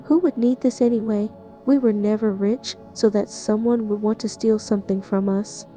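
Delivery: ordinary talk, nothing marked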